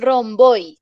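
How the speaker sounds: put-on romboy, hispanicized "rom-BOY"; mal pronunciado. Shown as a demonstration. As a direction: roundabout garbled into Spanish romboy. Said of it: "está muy mal pronunciado". (romboy a la española, "rom-BÓI")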